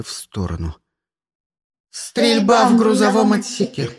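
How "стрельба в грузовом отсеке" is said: loud, raised ship-system voice; robotic alarm announcement. A narrator's reading. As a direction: announce an emergency over the speakers. (громкое объявление бортового ИИ)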